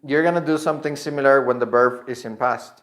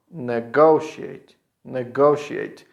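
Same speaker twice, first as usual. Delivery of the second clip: very slow "Negotiate" with strong GO; stress on go; slower than usual. Shown as a demonstration. Teacher shows where stress is, slow "Negotiate" on GO. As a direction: lean slowly on GO, ne-GO-shee-ate in "Negotiate". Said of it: "here the stress is in go".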